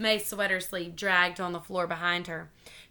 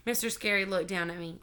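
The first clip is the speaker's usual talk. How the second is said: plain reading voice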